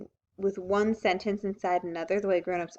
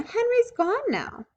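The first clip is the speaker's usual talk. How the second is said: put-on much higher, slow sing-song child; smug little girl; 4 dB louder, much slower than usual. A narrator's reading. Ramona gloating to Beezus, high and slow. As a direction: drag it out smugly like a pleased kid. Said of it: "said Ramona".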